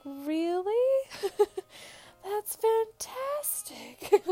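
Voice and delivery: high, dreamy mock delight, drawn out with laughs; blissed-out epidural daze. New mom on epidural hearing baby is halfway out, blissfully oblivious. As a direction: play yourself happily out of it, voice lifted high. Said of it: "in another world".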